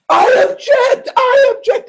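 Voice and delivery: high, loud courtroom outcry; acted objection. Opposing attorney interrupting the question, high mock-outraged shout. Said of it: "other attorney said".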